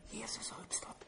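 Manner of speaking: breathy secret whisper, no voice at all. Pretend. Preacher mocking the whisper game with a whisper. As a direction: hush it like a secret. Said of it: "Gick de omkring och viskade?".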